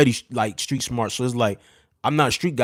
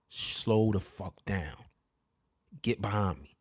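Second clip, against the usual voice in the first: much softer, very slow, firm under the breath; hushed command; 4 semitones lower, 11 dB quieter, slower than usual. Retold or imitated. Ordering his girl behind him, hushed and slow. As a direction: order her behind you without being heard. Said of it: "I said".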